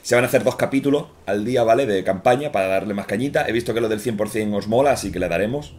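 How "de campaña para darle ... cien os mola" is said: normal talking voice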